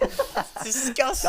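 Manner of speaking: slow, cracking up mid-word; laughing mock disgust. Real, not acted. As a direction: try to sound appalled but laugh. Mock outrage through laughter.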